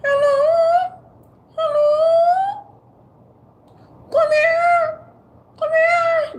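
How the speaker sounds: high shouted come here; granny calling. Pretend. Granny calling the kids over, high and loud. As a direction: holler like an old granny. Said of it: "Talk up in your nose".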